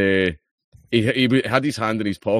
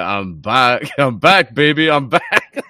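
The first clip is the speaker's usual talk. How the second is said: cocky return; 5 semitones higher, 5 dB louder, much slower than usual. Retold or imitated. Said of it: "and I'm like".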